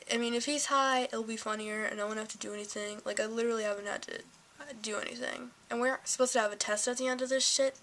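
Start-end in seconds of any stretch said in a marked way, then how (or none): none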